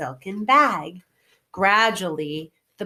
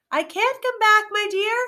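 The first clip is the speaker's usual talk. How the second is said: higher call from the basket; 13 semitones higher than usual. Acted. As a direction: call back down to the girl. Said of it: "called Oz from the basket".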